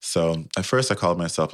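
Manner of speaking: ordinary delivery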